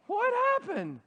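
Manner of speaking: high, baffled question. Retold or imitated. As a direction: sound totally lost. Confused player after touchdown.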